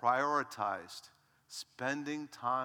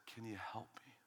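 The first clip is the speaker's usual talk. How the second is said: much softer whispered plea; 3 semitones lower, 16 dB quieter than usual. Acted. Leper begging Jesus for help, whispered plea. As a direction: beg for help under your breath.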